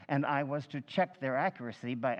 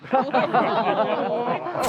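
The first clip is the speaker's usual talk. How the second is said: loud laugh pitched up, rising at the end; incredulous laugh; 8 semitones higher, 10 dB louder than usual. Acted. Chairman reading six identical claims, loud disbelieving laugh. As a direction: laugh at the absurdity.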